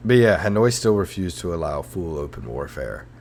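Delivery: ordinary talk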